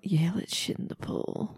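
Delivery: hushed conspirator whisper; whispered scheme. Retold or imitated. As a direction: whisper it like a plot.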